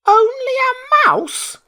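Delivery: very high, loud, slow indignant cry; indignant squeak. Acted. Mouse queen offended at being called only a mouse, indignant squeak. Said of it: "cried the little animal indignantly".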